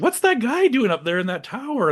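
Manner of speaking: mock puzzled investor. Investor asking about the man in the tower, puzzled high voice. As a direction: squeak it out like a baffled rich investor.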